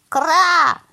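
loud, high, drawn-out caw; squawking crow call. A narrator's reading. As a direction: screech it like a crow. Crow character caws in story.

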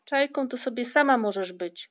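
disdainful brotherly put-down; scornful. A narrator's reading. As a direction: throw it back at a sister with contempt. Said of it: "odpowiedział jej brat z pogardą".

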